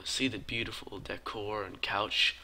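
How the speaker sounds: usual speaking voice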